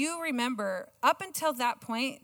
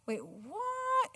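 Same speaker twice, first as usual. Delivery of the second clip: put-on child's surprise; 11 semitones higher, 7 dB quieter than usual. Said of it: "he was like".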